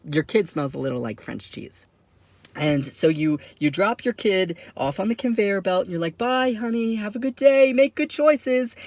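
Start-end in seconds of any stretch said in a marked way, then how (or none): 6.2-8.8 mock doting parent calling after a child; parent's send-off. Parent dropping a kid at school, high sweet send-off.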